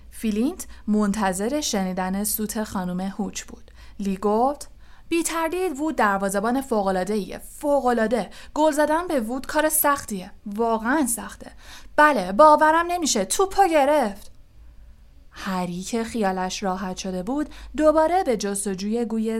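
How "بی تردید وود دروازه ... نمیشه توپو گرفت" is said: building from admiring to loud, high amazement; commentate, bursting higher and louder at the end; excited match commentary. Acted. Lee reports Wood saving the penalty, excited commentary.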